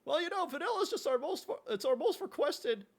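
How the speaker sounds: Morty voice. Pretend. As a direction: squeak it out like a flustered kid.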